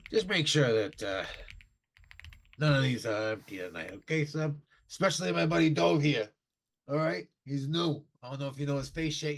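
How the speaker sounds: loud, high barroom bluster at the waiter; a tipsy regular throwing his weight around; gruff drunk patron. Acted. Drunk patron calls out to the waiter, loud and high. GM voices Nine Fingers to Seb.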